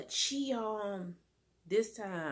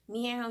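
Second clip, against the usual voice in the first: imitated meow; 4 semitones higher than usual. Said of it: "how cats be making a meow song".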